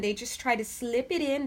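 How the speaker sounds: ordinary talk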